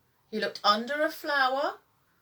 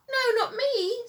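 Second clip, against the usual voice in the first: very high squeak; the mouse denying it, very high and squeaky; 14 semitones higher, 3 dB louder than usual. A narrator's reading. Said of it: "said Mouse with a squeak".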